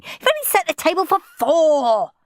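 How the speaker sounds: high, loud complaint; indignant child. A narrator's reading. Henry protesting the table for four, indignantly. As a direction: push the objection up high and loud.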